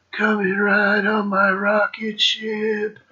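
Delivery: high, slow, soft crooning parody; mock singer. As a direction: drag it out like a lazy crooner. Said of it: "listen to fucking [name]".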